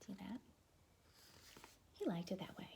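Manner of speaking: plain reading voice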